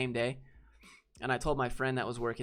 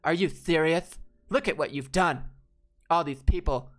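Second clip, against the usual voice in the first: higher, louder put-on indignant voice; indignant customer; 4 semitones higher, 6 dB louder than usual. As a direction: rant it in a shrill entitled voice. Customer refuses to pay.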